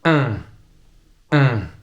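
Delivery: un said through the nose as a nasal vowel; slow textbook pronunciation. Shown as a demonstration. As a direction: push un through the nose slowly. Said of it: "we have the sound".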